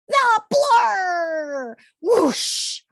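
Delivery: high, loud triumphant cry of the hero's name; excited shout. A narrator's reading. Reading to kids, trumpeting the hero's big reveal. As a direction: announce the Blur like a superhero reveal. Hero name exclaimed in story.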